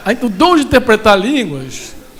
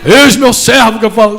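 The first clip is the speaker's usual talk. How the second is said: loud, booming put-on divine proclamation; hammed-up prophetic God voice; mock voice of God; 9 dB louder than usual. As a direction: imitate God addressing a servant, much louder. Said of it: "imitar a voz de Deus".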